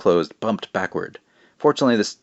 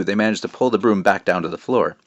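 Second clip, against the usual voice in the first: usual speaking voice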